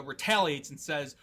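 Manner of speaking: ordinary talk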